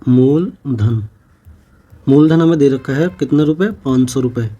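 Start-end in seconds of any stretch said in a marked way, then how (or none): 0.0-1.1 drawn-out dictation. Shown as a demonstration. Writing word on board.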